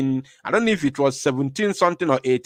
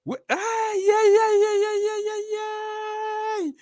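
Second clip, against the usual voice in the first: high, breaking cries of yeah; choking up, voice breaking into high wails; overcome with emotion; slower than usual. Real, not acted. Streamer on live to his viewers, breaking down in tears. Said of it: "I don't want to be emotional".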